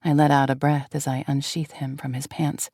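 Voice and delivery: ordinary delivery, nothing marked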